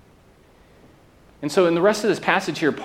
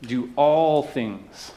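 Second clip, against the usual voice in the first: slow emphatic all; stress on all; much slower than usual. Shown as a demonstration. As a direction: Do ALL things, slow, leaning on all. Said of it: "challenged by this word all".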